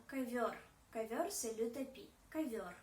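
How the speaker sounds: ковёр said kavyor, unstressed o like a; ковёр with reduced first vowel as kah; ka-VYOR, stress on the second syllable; Russian ковёр. Shown as a demonstration. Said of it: "O devient plutôt A".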